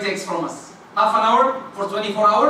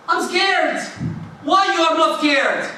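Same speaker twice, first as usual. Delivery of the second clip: higher, pleading fearful wife; mock scared wife; 7 semitones higher, slower than usual. Wife pleading with husband in storm, lightly fearful. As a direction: voice her rising fear on the ship.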